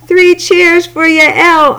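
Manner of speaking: high, slow, cheerful call; proud uncle's hearty cheer. Acted. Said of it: "Uncle cried".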